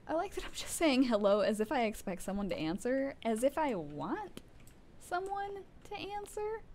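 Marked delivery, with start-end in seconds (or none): 5.0-6.6 high, soft and drawn out; playful high voice. Pretend. Player joking about wanting someone to answer, high soft voice. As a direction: go up high and slow, quietly. Mocking own wish for an answer.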